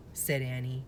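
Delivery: plain reading voice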